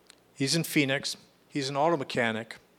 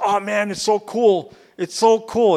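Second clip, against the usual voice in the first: high, loud, thrilled outburst; excited friend; 8 semitones higher, 8 dB louder than usual. Retold or imitated. Friend phoning from jail, bursting with excited joy. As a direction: gush about how great it is. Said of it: "I could just hear some excitement in his voice".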